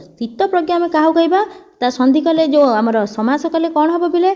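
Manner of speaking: ordinary talk, nothing marked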